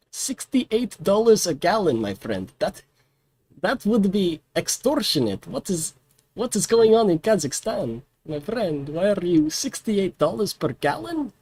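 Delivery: put-on indignant diplomat, a touch of Russian accent; hint of Russian accent. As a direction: play a haggling diplomat with a light Russian accent. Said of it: "sounds like I'm doing a Russian accent".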